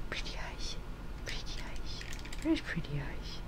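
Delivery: whispered to the cat; hushed whisper admiring the cat's eyes. Real, not acted.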